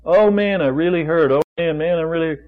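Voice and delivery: a hammed-up moan of pain; mock complaining. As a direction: whine like a hurting complainer. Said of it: "What's your witness about your pain levels?".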